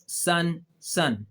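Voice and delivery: "सन" with a long, slow hissing s; drawn-out s sound. Shown as a demonstration. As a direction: stretch the s at the start of "सन". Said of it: "सीन की आवाज़ देता है". ("सन" को लंबी सीन की आवाज़ के साथ धीरे बोलें)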